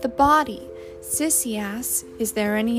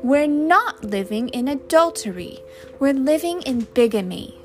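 raised, louder quarrelling voice; higher, emphatic retort; indignant correction; 4 semitones higher, 7 dB louder than usual. A narrator's reading. Arguing over adultery and bigamy, indignant.

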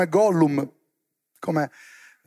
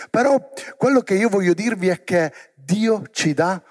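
ordinary talk

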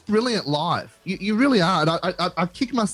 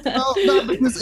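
laughing; 8 semitones higher than usual. Real, not acted. Laughing reply on air.

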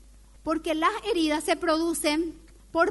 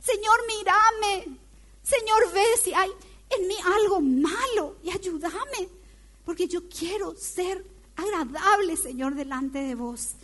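higher, slow, pleading prayer to God; humble prayer; 5 semitones higher than usual. Acted. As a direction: lift it up like a sincere plea to the Lord. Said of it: "¿qué es lo que yo hago?".

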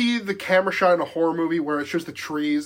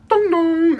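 doorbell chime, second note much higher; imitated doorbell; 11 semitones higher, 5 dB louder than usual. Storyteller imitating the doorbell that startled them, sung chime. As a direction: sing out a two-note doorbell, louder at the end. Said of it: "the fucking doorbell rings".

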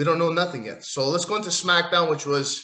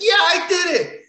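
much higher and louder gloating cheer; mock celebration; 14 semitones higher, 6 dB louder than usual. Vlogger voicing Seth Rollins celebrating, hammed-up gloating. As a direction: crow it like a smug winner.

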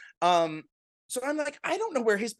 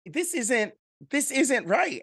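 higher-pitched mock objection; mock dismay; 7 semitones higher, slower than usual. Host reacting to water all over the bar, mock dismay. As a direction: raise the pitch in fussy disbelief.

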